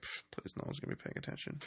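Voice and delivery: very soft, fast brush-off; imitated offhand scoff, barely voiced; mock dismissive. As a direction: wave it away under the breath. Boss waves the worry away.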